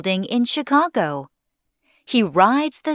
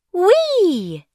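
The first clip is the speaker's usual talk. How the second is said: high, drawn-out squeal of delight; gleeful squeal; 10 semitones higher than usual. A narrator's reading. Young hair enjoying the wind, gleeful squeal. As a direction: let out a high, thrilled whoop. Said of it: "he screams".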